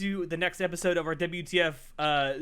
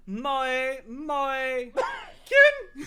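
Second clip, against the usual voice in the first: high cutesy maid chant; 7 semitones higher, 4 dB louder, much slower than usual. Pretend. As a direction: squeal it like a maid cafe girl. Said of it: "I can also do this".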